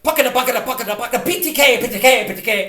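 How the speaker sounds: fast, loud tongue-twister rattle; showing off. Retold or imitated. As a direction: rattle it off to show off. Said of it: "I was doing something like".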